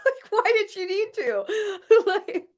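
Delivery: high-pitched words squeezed through laughter; helpless giggling as you talk; laughing. Real, not acted. Joking about why she needed an accent, laughing hard. Cracking up at own joke.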